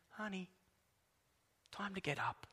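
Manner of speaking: soft, hushed coaxing; whisper-soft call to a sleeping child; tender wake-up. Retold or imitated. God at the bedside gently waking us, soft and tender. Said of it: "sit down by the bedside and say".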